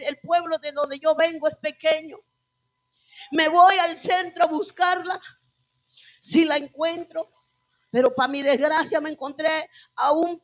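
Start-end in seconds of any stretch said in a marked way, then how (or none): none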